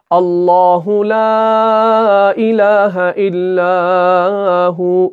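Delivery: slow chanted "अल्लाहु ला इलाहा इल्लाहु"; Ayat al-Kursi recitation. Shown as a demonstration. Teacher recites Ayat al-Kursi to show the reading, slow chant. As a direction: recite it slowly as in prayer. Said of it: "हम पढ़ते हैं".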